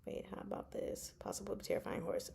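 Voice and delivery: fast whispered reading to herself. Real, not acted. Checking her shirt-idea notes, whispering fast. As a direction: murmur it under the breath while scrolling.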